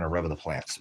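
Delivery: ordinary talk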